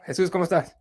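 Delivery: imitating her Brazilian accent lightly; greeting in a hint of a Brazilian accent; mock Brazilian accent. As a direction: put on a teasing Brazilian accent. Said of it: "is her accent, bro".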